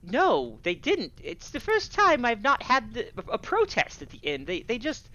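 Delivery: high-pitched tavern keeper voice; flustered tavern keeper. Acted. Game master voices tavern keeper.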